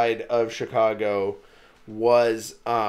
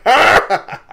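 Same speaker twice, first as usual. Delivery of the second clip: laughing zombie roar; 9 semitones higher, 12 dB louder than usual. Retold or imitated. Zombie roars again, laughing.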